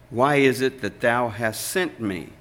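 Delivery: ordinary talk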